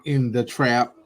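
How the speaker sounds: ordinary talk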